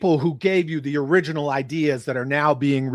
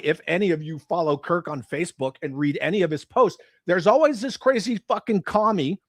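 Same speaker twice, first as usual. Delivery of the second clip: usual speaking voice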